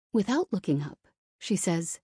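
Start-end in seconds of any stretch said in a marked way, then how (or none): none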